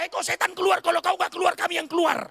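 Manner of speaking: loud, high commanding shout; mock exorcism prayer. Preacher jokes about a prayer they could never pray, mock exorcism shout. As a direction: shout a pretend order to cast out a devil. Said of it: "nggak mungkin kita berdoa".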